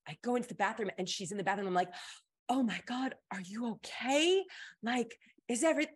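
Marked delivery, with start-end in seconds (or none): 2.5-5.9 higher-pitched, worried questioning; mock urgent check on a friend; acted alarmed concern. Classmate finding her friend in the bathroom, acted alarm.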